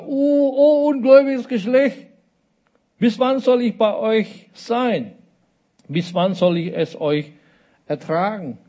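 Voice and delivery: high-pitched, hammed-up exasperation; theatrical groaning complaint; exasperated rebuke. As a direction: lament it loftily, starting high and easing down. Acting out Jesus' exasperated rebuke.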